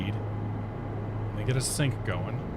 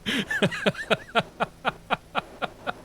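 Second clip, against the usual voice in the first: giddy high-pitched laugh; 11 semitones higher than usual. Real, not acted. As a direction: burst into a squeaky giggle. Laughing after the dark lord boast.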